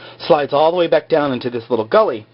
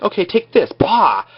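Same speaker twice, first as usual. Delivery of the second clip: higher, jeering villain voice, punching out the bah; mock fight taunt; 7 semitones higher than usual. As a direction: taunt him like a cartoon baddie mid-swing. [name] taunting Jack mid-fight.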